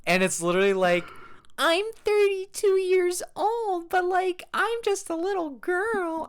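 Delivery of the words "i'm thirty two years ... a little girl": put-on high, slow little-girl voice; mock a grown woman's baby talk. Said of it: "talking in an extreme baby voice".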